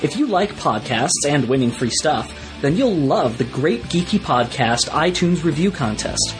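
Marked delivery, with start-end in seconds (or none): none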